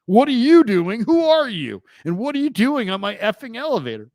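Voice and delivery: very high, loud furious demand; angry Howard. Retold or imitated. Howard grilling the stranger on his elevator, high and loud. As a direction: bark it like an outraged star. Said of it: "he goes".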